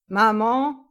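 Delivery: loud questioning call, rising at the end. A narrator's reading. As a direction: call into the flat to see who is home. Son calls out on arriving home.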